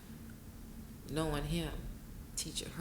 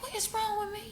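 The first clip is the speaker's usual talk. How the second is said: much higher, baffled; bewildered self-question. Retold or imitated. Widow recalling her own surprise at her tears. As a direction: squeak it out in disbelief at yourself. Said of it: "I'm like".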